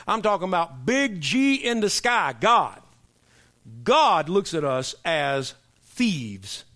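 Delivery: slow, heavy stress on God; emphatic preaching. Real, not acted. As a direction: lean hard into God, draw it out. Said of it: "I'm not talking about my belief".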